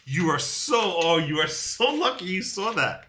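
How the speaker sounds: exasperated cry at a near win; exclaiming. Real, not acted. Reacting to son blocking his winning move.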